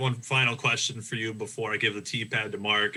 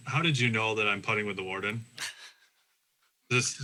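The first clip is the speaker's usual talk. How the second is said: ordinary talk, nothing marked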